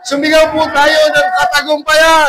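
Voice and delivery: loud, high call to shout; rally them to cry victory; preaching. Acted.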